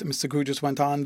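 usual speaking voice

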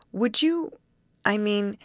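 hesitant, high-pitched, broken-off offer; falter, restart, then ask on a high pitch; half stammered. A narrator's reading. Betty awkwardly offers the youth help, half stammering. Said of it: "half stammered Betty".

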